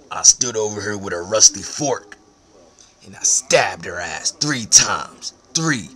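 usual speaking voice

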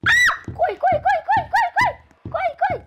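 very high panicked shouting; being chased. Real, not acted. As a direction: scream the warning while running for your life. Pig chasing, player fleeing.

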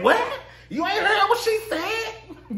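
very high, laughing; laughing mock disbelief. Cast reacting to Drew, very high laughing mock disbelief. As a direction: squeal it incredulously. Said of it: "everybody like".

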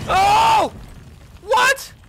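high, loud yelp of disbelief; real jolt of surprise, loud and high; startled shout. Real, not acted. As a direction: shout out in shock. Startled by sudden attack in game.

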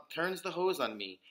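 usual speaking voice

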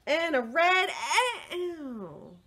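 high strained cry; mock pained yelp. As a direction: yelp as it goes wrong. Crayon hurts drawing an ant.

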